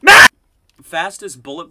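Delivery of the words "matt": high, very loud yell at a teammate; real alarmed shout. Real, not acted. Player crying out to teammate Matt, alarmed shouting. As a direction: yell out in protest, building. Yelling at teammate mid-game.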